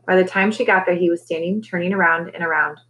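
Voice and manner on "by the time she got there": ordinary delivery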